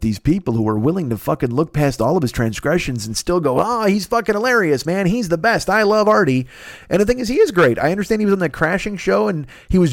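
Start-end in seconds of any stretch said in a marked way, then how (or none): 3.6-6.5 high, gushing fan imitation; mock adoration. Fans looking past all of Artie's transgressions, mock adoration. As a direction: gush as a fan who forgives everything.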